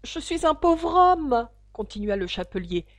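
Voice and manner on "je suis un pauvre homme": high, loud, slow plaint; drawn-out nervous plea; pleading hatter. A narrator's reading. The poor hatter resumes his testimony, pleading.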